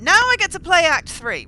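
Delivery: high, loud and eager; excited. Real, not acted. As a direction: burst out with glee. Excited about next act.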